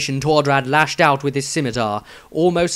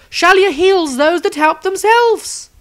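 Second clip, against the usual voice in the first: protesting holy woman; 6 dB louder than usual. Acted. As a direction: urge the frozen wizard to act. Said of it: "protested the holy woman".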